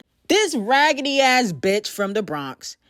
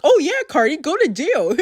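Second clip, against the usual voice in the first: mock yes men; 5 semitones higher, 4 dB louder than usual. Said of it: "they like".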